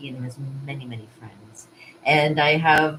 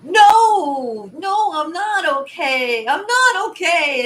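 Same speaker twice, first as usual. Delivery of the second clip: higher, louder, slow tearful cry of no; drunken tearful wail; 12 semitones higher, 8 dB louder, much slower than usual. Retold or imitated. Stumbling home drunk, answering a stranger with a slow, loud tearful wail. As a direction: wail it like you're crying your eyes out. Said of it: "crying my eyes out".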